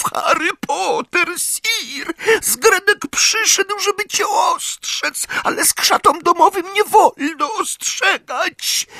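very high, screeching elf voice; Zgredek. A narrator's reading. Elf warns Harry against the rules, high screech. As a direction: squeal it out in a trembling panic. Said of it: "zaskrzeczał Zgredek, dygocąc".

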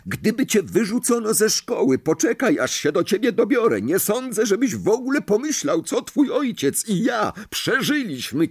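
very high, furious scolding; shrieking rant. A narrator's reading. Mother's Howler berates Ron before the whole hall, shrieking. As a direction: rail at him in a high screech. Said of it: "Wrzaski pani Weasley".

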